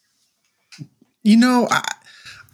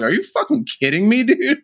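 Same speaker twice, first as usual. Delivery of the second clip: disbelief; 9 semitones higher than usual. Retold or imitated. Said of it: "I'm like".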